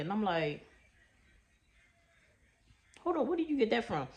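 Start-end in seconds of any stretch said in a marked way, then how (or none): 3.0-4.1 shocked disbelief. Retold or imitated. Son's question shocks her.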